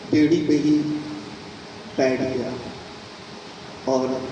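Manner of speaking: ordinary delivery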